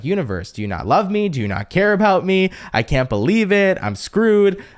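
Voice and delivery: hammed-up distress at the universe; panicked reaction. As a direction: play the freaked-out victim, voice climbing. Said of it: "A reaction would be like".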